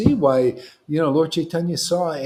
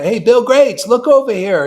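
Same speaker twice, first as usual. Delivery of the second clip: loud, higher mock call for attention; 7 semitones higher, 10 dB louder than usual. Preacher mock-calling Bill Gates to look, loud. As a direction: shout it across the room to get noticed.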